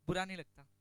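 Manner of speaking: usual speaking voice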